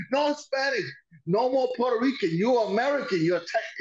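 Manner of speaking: high shouted broken English; yelling wrestler. Retold or imitated. Imitating Dick Murdoch yelling on live TV. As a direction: bellow it like a crazed heel.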